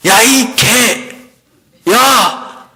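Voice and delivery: high, drawn-out acted cry of abuse; slow, high-pitched hostile yell, acted; possessed woman's cursing. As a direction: imitate a demon-possessed woman lashing out. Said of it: "그 여자분이 이러는 거예요".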